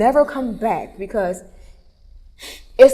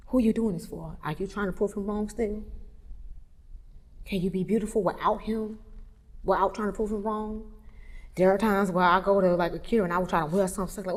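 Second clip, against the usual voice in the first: normal talking voice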